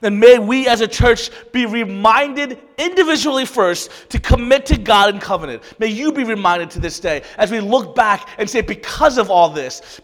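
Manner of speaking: loud, rising sermon delivery with pushed emphasis; fervent preaching. Acted. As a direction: preach it to the whole church. Preaching to the congregation.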